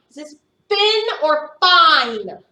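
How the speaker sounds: "fin" short i against "fine" long i, slowed; vowel contrast. Shown as a demonstration. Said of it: "Do you hear it or I?".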